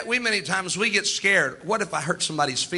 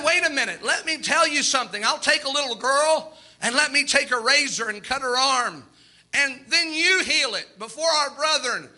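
scheming swindler voice; 6 semitones higher than usual. Retold or imitated. Retold scheming proposal.

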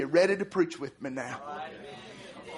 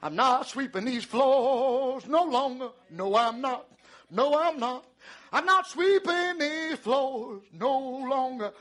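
higher, drawn-out sing-song refusal; defiant glee; 5 semitones higher than usual. Acted. Preacher acts the woman quitting her kitchen chores, gleefully defiant. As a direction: chant it like a woman done with chores.

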